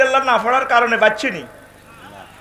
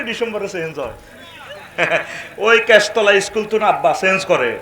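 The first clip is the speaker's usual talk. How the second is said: normal talking voice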